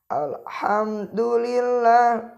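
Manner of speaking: alhamdulillah with the mim held smooth, not bounced; correct recitation. Shown as a demonstration. Teacher reciting alhamdulillah with a smooth mim. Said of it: "mim juga sama, jangan dipantulkan".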